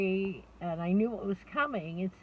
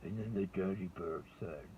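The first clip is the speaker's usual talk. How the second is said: low storybook voice for the daddy bear; daddy bear storytelling; 13 semitones lower, 4 dB quieter than usual. Shown as a demonstration. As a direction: growl it out gently like a papa bear. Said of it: "take on the voice of that character".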